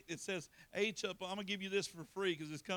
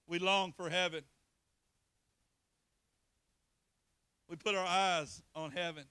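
loud, emphatic preaching; sermon at full force; 5 dB louder than usual. Acted. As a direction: drive each line home to the church. Full preaching, louder throughout.

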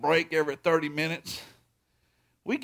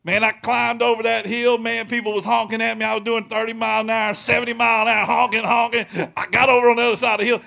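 louder, higher and racing at the end, reliving the ride; excited retelling; 5 semitones higher, 7 dB louder than usual. Retold or imitated. Preacher tells his congregation about the highway ride, excited and loud. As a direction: pour out the wild ride over the hill, louder and higher.

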